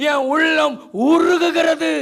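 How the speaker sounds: slow, loud, drawn-out outcry; loud lament, stretched slowly; anguished cry. Acted. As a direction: cry the line out as a broken plea. Psalm lament, preacher cries out.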